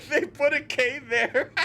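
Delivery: high, loud cracking up; real giggling fit; laughing. Real, not acted. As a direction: say it through helpless laughter. Cracks up at the game's spelling.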